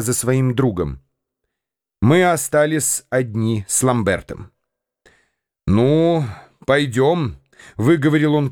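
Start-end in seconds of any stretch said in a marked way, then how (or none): none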